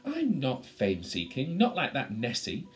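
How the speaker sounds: loud, huffy creature setting itself apart; indignant denial. Acted. Displeased creature defends itself.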